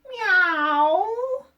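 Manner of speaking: loud high cat meow; big cat meow from the basket. A narrator's reading. Cat in the basket meowing loudly. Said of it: "She meowed a big meow".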